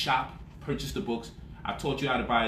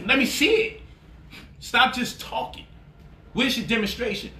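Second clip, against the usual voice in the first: higher, laughing dare; taunting critics who only talk; taunting challenge; 8 semitones higher than usual. Real, not acted. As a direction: call them out, up high.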